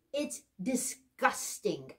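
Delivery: slow, drawn-out disgust; emphatic disgust. Real, not acted. Host on dirty dishes in the hallway, slow disgust. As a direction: stretch out each syllable in revulsion.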